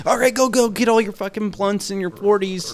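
high hurried shopkeeper voice; hurried shop owner. Retold or imitated. Old shop owner rushing teens, high mock hurry. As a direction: rush the kids out with their stuff.

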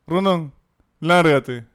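loud stern order to get out; teacher's order to leave. Retold or imitated. Teacher ordering Rondón out of class, loud stern shout. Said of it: "me dice".

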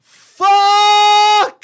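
high, long scream of a curse; huge drawn-out panicked shout; full yell. Acted. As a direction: yell it out at the top of your lungs. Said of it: "and just yell".

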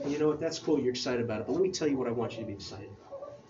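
a calm nudge, lightly voiced; Jesus gently redirecting. Jesus to his disciples, quietly steering their joy. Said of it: "And like".

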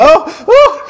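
very high, squeaky yelp; spotted driving off, squeaky mock alarm. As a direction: yelp like you've been spotted. Hiding in the Uber.

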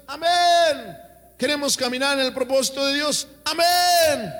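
high, loud preaching, climbing to shouted amens; fiery preaching. Acted. Pastor rouses the congregation to answer amen, preaching at full force. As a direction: preach it hard and pull the crowd's amen.

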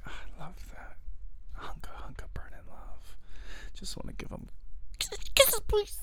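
breathy whispered swoon. Retold or imitated. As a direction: whisper it, lovestruck. Swooning over partner.

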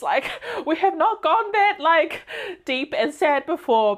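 high, laughing exclamation. Retold or imitated. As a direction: squeal it out through a laugh. Quoting what she told herself.

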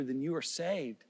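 ordinary talk

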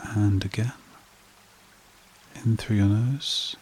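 slow meditation voice with long pauses; guided meditation. Acted. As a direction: guide listeners gently into calm, phrase by unhurried phrase.

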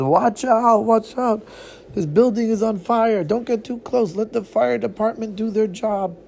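high, loud warning shout; calling out warning. Acted.

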